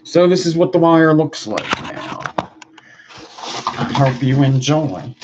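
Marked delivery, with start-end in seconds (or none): none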